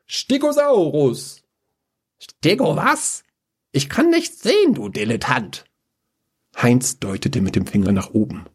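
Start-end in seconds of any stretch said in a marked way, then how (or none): 0.1-1.4 slow, high, drawn out cry; summoning call. Acted. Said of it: "Und er rief". 2.2-5.6 very high, scornful jeer; mocking. Mage mocks the summoning.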